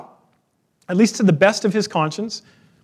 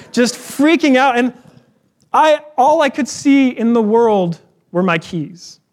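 usual speaking voice

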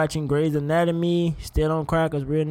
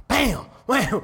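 onomatopoeia; 6 semitones higher than usual. Pretend.

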